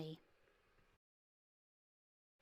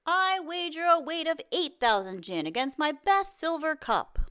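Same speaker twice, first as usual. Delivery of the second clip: higher and louder, calling out a bold bet; shouted wager; 9 semitones higher, 5 dB louder than usual. A narrator's reading. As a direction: shout the bet over the crowd. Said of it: "Another shouted".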